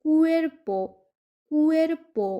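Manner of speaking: কুয়ের্পো with a hard ক, carefully modelled; Spanish word cuerpo. Shown as a demonstration. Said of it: "সে এর উচ্চারণ হচ্ছে ক এর মতো".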